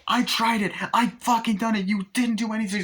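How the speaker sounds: imitating a frantic, furious complaint; mock angry outburst. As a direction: protest angrily as the wronged heroine. Acted outburst from horror film scene.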